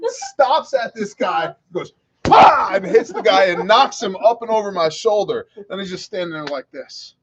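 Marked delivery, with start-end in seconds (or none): 2.2-2.7 loud, high explosive pah; dad's knockout punch, as a loud pah; punch sound. Retold or imitated. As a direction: spit out the noise of a fist landing.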